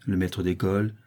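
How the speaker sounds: ordinary delivery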